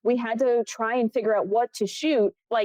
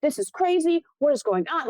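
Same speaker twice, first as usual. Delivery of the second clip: high-pitched mock outrage; indignant, raised-pitch mock tirade; retold yelling; 6 semitones higher than usual. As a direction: imitate someone yelling in your face, without full volume. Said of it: "yelled in my face".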